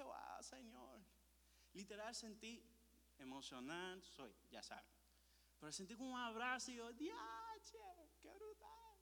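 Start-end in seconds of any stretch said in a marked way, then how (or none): 0.0-1.0 high, soft moan; broken-down awe. 6.9-9.0 very high, soft squeal; awed amazement.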